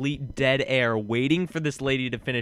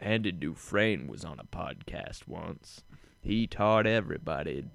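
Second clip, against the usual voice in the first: slow, low, soft narrator impression; hushed deep storyteller voice, unhurried; Morgan Freeman; 8 semitones lower, 8 dB quieter, slower than usual. Co-host asked for an impression, low slow narrator. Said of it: "can you do a Morgan Freeman?".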